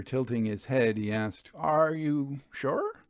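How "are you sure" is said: a hint of a smug swindler, rising higher; oily blackmailer pressing his price; lightly voiced Lavelle. A narrator's reading. Lavelle questions Vera.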